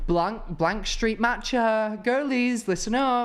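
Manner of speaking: put-on rally the girlies like an influencer; basic girl.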